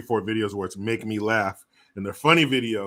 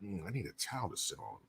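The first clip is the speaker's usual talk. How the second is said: very soft, low mock-sultry murmur; imitated interested woman; 4 semitones lower, 19 dB quieter, faster than usual. Streamer imitating Kamala's interest in old men, soft low murmur. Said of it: "she's like".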